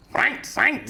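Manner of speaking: high-pitched, repeated mock German France; mock German accent. Shown as a demonstration. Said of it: "No, I think that's German".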